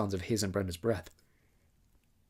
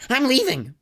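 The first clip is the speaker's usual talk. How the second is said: sobbing; 8 dB louder, slower than usual. A narrator's reading. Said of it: "her words broken by a sob".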